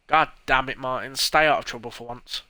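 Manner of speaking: loud, fed-up scolding; aunt yelling at Martin; angry aunt. Acted. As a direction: tear into the troublemaking son.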